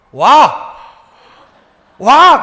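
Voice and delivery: rising loud "와!" twice; exclaiming wow. Retold or imitated. Father amazed at his son, with a loud wow. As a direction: call out in wonder like a proud father. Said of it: "그랬어요".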